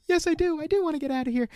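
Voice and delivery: high, soft and giddy. Retold or imitated. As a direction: gush it like a girl who finally said yes. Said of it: "she is so giddy".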